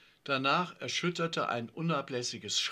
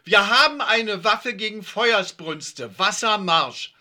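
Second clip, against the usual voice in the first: loud, slow, high announcement; firm proclamation; 7 semitones higher, 10 dB louder than usual. Pretend. Yvonne scripts Kali's line, firm loud proclamation. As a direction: proclaim it like a staged battle order. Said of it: "Du verkündest bestimmt".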